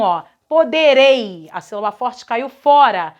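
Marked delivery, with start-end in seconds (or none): 0.5-1.5 Poderei drawn out, loud on REI; stress on final syllable. Shown as a demonstration. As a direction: pronounce Poderei slowly, punching the final rei. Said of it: "pronunciar cada um".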